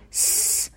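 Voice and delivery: س hissed alone, without any vowel; sustained s sound. Shown as a demonstration. Teacher joins s to next letter, sustained hiss. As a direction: hold a pure س hiss before joining it on.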